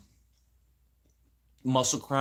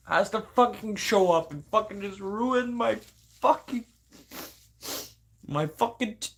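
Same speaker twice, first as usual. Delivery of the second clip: upset venting; 7 semitones higher than usual. Real, not acted. Venting about relative ruining the week.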